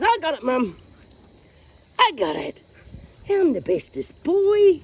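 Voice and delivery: high, loud, excited doggy voice; boastful dog. Acted. Owner voices her dog playing with a stick, loud boasting. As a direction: play the proud pet, stretching the last words high. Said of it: "Chan says".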